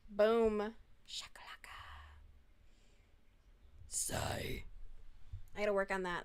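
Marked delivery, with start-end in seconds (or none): 0.1-1.8 whispered voice try. Pretend. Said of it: "I'm gonna work on my voice". 3.9-4.6 whispered voice try. Pretend. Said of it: "I'm gonna work on my voice".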